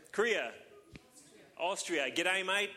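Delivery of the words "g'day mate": a lighter jokey Aussie "G'day, mate"; Australian accent. Pretend. Pastor teasing the crowd with the Australian joke, light Australian accent. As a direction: toss off the Australian greeting again as a tease.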